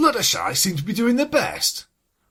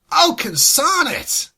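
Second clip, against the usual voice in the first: frustrated; 5 semitones higher, 5 dB louder, slower than usual. Acted. Applejack caught out, cursing.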